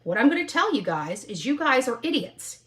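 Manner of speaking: ordinary talk, nothing marked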